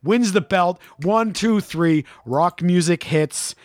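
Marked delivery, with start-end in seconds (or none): none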